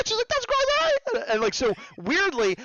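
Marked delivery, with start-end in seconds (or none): 0.3-1.2 very high, squealed delight; over-the-top retold glee; imitated excitement. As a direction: act out a thrilled listener squealing. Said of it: "bigger explosion of emotion with her".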